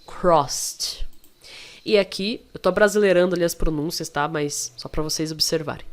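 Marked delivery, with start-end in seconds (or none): 0.0-1.1 CROSSED drawn into a hissed "crost". Shown as a demonstration. Teacher repeats the past of cross, ED as T. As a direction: finish CROSSED with airy ST, no vowel. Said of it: "É como se você falasse isso".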